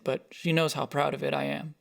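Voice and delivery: ordinary delivery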